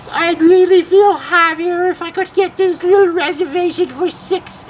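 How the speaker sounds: put-on very high, quavering old lady voice; little old lady. Prank caller phoning a restaurant as a little old lady. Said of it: "little old lady voice".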